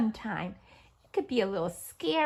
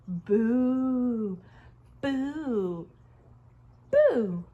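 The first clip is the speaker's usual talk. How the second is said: ghost boo; 6 dB louder, much slower than usual. Pretend. Acting ghost.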